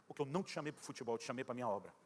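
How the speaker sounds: God's voice answering the complaining player, low and solemn. Retold or imitated. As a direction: speak quietly from above, grave and calm. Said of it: "Aquela voz disse".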